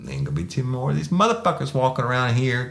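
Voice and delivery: high mocking taunt; cocky threat. His own retold threat.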